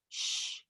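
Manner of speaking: gentle unvoiced shh; a quiet shushing breath; soft shush. A narrator's reading. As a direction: hush a sleepy child. Hushing the truck to sleep.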